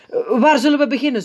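high, fast question; hasty question. A narrator's reading. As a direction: hurry past it. Opperpiet asking sister.

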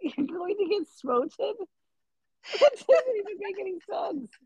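high-pitched, incredulous questioning; baffled disbelief. Real, not acted. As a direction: question it as if your brain broke. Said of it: "my brain broke there".

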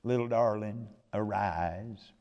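tender whisper. Acted. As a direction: breathe it gently, like waking a sleeping child. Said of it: "a term of endearment".

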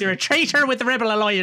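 high, loud imitation of Vader's lines; Dave Prowse. Vader accusing a rebel, mock Prowse voice. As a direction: bark the villain's lines up high. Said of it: "having Dave Prowse do his act".

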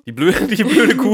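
slow, loud words broken by real laughter; laughing. Real, not acted. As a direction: burst out laughing while repeating it. Repeating the joke, laughing.

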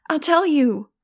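loud, high panicked scream; terrified scream. A narrator's reading. Falling director screams surrender to her captor, panicked scream. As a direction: yell it out as you fall. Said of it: "she screamed".

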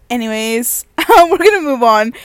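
laughing. Real, not acted. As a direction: laugh your way through moving on. Laughing at her own confusion.

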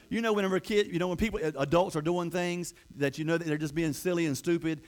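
ordinary talk, nothing marked

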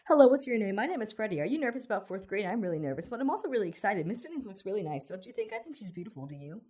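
nonstop rambling. A narrator's reading. Red-headed boy peppering his new classmate with questions, nonstop rambling. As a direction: ramble on without pausing for breath. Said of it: "The red-headed boy rambled on and on and on, without pausing".

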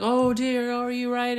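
high-pitched mock British concern; British accent. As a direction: fuss in a high, put-on British voice. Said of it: "British accent".

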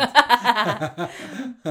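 high, loud burst of laughter. Real, not acted. Partner cracks up at the send-it-back-to-Poland joke, loud high laughter.

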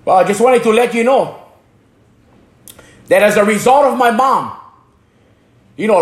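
ordinary talk, nothing marked

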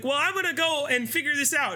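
put-on high, insistent young woman's voice; the daughter insisting she'll find out; determined daughter. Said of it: "she's like".

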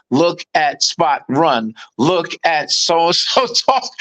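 slow, word-by-word beginner reading; mocking, halting read with big gaps between words; mock primer reading. Guessing the bullies' dialogue, mock-simple slow reading.

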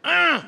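high, loud startled grunt at the car; exasperated grunt. Retold or imitated. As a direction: grunt as if someone cut you off. Car cuts you off.

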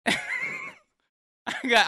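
a very high laughing squeal; shrieking laugh. Real, not acted. Host shrieking with laughter at the guest.

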